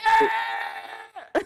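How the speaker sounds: high, strangled cry of pain; suppressed pain scream. Acted. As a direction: hold back a scream that still escapes. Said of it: "trying to not, like, scream out in pain".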